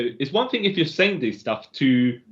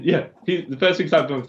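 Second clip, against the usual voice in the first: normal talking voice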